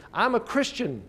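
high-pitched, showy proclamation; hammed-up self-declaration; mock announcement. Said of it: "You don't have to announce it".

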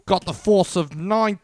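high, slow, loud mock Aussie; botched Australian accent. Mock officer boasting of kangaroo power, botched Australian accent. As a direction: stretch it out in a bad Australian accent. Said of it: "I think I'm doing New Zealand".

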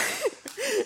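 sudden high burst of laughter; laughing. Real, not acted. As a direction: cracks up at own words. Laughs at own slip.

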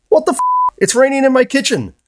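shrill high New York neighbor yelling; New York accent. Retold or imitated. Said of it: "In her heavy New York accent".

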